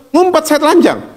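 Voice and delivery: high-pitched mock confession; Adam ngumpet. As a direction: pipe up as the one hiding. Mimicking Adam hiding as told. (nada tinggi menirukan Adam)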